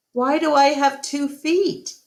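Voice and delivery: lightly voiced little elephant wondering; puzzled child. A narrator's reading. Ruby questions Ivan's drawing, lightly childlike.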